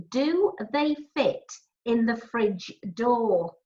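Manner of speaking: slow, halting repeat of the question. Acted. Milky repeats the question slowly and haltingly. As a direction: drag out "in the fridge door", stumbling. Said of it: "he stutters".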